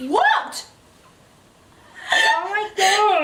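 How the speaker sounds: high shocked "what". Real, not acted. Co-host hearing the line, high shocked question. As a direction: react in shock.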